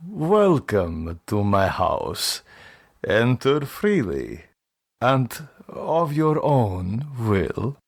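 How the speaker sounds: put-on slow, foreign-sounding old count; strange intonation. The old man welcoming the clerk at his door, slow and strange. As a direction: draw out the welcome slowly like an eerie host. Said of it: "but with a strange intonation".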